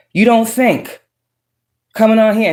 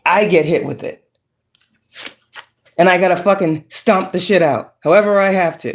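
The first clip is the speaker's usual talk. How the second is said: ordinary talk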